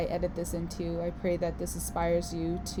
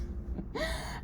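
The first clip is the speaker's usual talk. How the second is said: breathy laugh; 5 dB quieter than usual. Real, not acted. Laughing after catchphrase.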